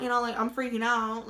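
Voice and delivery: ordinary talk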